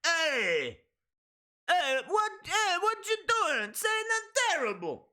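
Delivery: very high, hammed-up New York indignation; squealed mock-angry New York accent; mock-offended New York accent. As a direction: play an outraged New Yorker. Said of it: "Sorry, but terrible".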